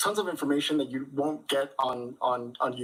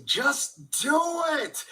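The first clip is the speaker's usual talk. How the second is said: high, slow, shouted-style motivational quote; Shia LaBeouf; 12 semitones higher, much slower than usual. Retold or imitated. Quoting Shia LaBeouf to viewers, high slow intense delivery. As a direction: belt it out like the famous meme. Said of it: "I'd have to quote Shia LaBeouf and just say".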